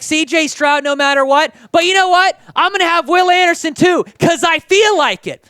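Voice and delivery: brash mock general manager deciding on a whim; cocky GM. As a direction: play the GM who takes whatever he wants. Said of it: "He was like".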